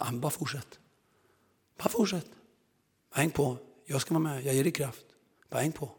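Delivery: soft, hushed encouragement; gentle inner voice. Retold or imitated. The Holy Spirit urging the tired preacher on, soft and hushed. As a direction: murmur it like a comforting presence.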